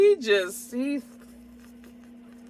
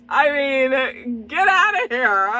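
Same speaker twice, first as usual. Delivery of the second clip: high, loud playful outburst; mock scolding; 7 semitones higher, 5 dB louder, slower than usual. Reviewer to viewers who haven't seen the movie, mock scolding. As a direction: shoo them off in mock outrage.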